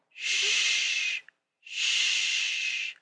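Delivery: long, soft, breath-only hush; drawn-out whispered "shh"; whispered shush. A narrator's reading. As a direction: shush them gently, no voice at all. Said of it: "Father Christmas whispered".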